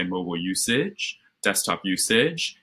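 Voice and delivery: "usage" lifted at the end like a question; upspeak. Shown as a demonstration. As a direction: let each "usage" rise at the end, unsure. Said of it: "tone goes up at the end of your sentences".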